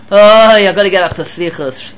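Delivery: loud groaned "oh", sinking into weariness; reluctant groan. Acted. Man dragging himself up for slichus, weary groan. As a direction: moan it like getting up is a burden. Acts out reluctant early riser.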